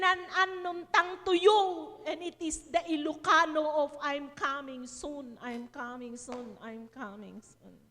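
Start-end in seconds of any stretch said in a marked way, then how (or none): 4.0-7.8 low, slow, fading softly; hushed repetition. Retold or imitated. Preacher repeating the translated message to congregation, hushed and slow. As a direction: let each repeat sink lower and quieter.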